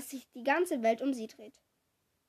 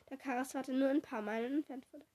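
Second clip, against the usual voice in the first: ordinary delivery, nothing marked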